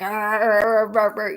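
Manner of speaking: loud growling arr like a rabid dog; rabid dog growls. Retold or imitated. Mock growling at family asking what's wrong. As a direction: snarl it out like a mad dog. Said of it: "you're like a rabid dog".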